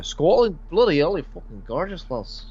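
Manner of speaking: put-on a clumsy, lightly done Scottish accent; bad Scottish accent. A smitten Scottish lad to a woman, lightly faked Scottish accent. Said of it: "attempt to do the bloody Scottish accent".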